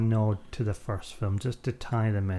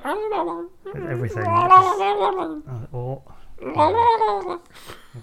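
very high, slow, strained creature squeal; Weasel voice; 6 dB louder, much slower than usual. Acted. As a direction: squeak it out like a pained little creature. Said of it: "That's my Weasel impression".

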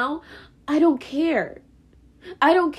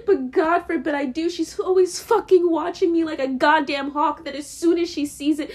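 normal talking voice

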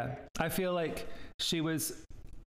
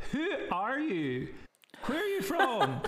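high, incredulous outburst, half laughing; mock fury; 10 semitones higher than usual. Retelling a first encounter with "yah", comically furious and high. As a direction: demand it in high-pitched, comic outrage. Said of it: "I was furious".

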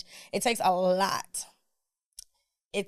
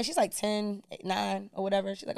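ordinary talk, nothing marked

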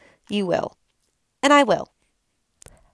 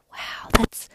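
hushed, retold amazement; voiceless, wonderstruck wow; awed whisper; 3 dB quieter than usual. Awed reaction to animation, retold.

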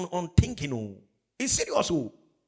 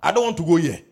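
louder mock call to steady yourself; retold loud warning; 10 dB louder than usual. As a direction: call it out to your own mind. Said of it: "I say".

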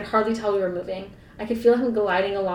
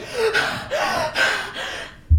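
mock swoony gasp; 13 semitones higher, 4 dB louder than usual. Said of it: "she's like".

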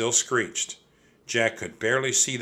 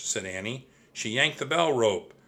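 ordinary delivery, nothing marked

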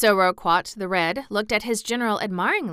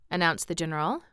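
usual speaking voice

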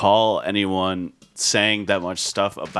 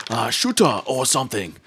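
Nute Gunray impression. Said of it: "the quote that I said".

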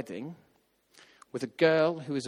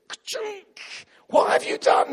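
much higher, breathless alarmed inner voice; panicked inner voice; 8 dB louder, slower than usual. Retold or imitated. To himself after speaking up, high panicked yelp. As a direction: yelp at yourself in horror.

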